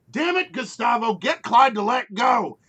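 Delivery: loud, fed-up yell at Gustavo; exasperated shout. Acted. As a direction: bark at him to sort out the beast. Passengers shout at the driver.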